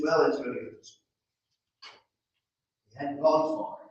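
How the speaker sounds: ordinary delivery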